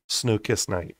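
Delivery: ordinary delivery, nothing marked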